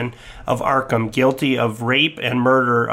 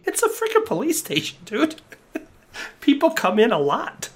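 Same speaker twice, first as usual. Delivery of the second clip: pitched way up, teasing; high mock voice; 10 semitones higher than usual. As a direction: squeak it out like a cartoon. Player mocks in high voice.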